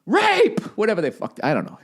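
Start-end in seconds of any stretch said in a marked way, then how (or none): 0.1-0.6 high shriek. Retold or imitated. Singer's refusal, comic.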